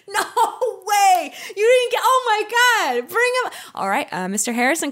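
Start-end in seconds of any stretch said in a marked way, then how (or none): 0.1-3.5 much higher, laughing and louder; delighted disbelief. Pretend. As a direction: squeal with joy at the news. Mock delighted guest reaction.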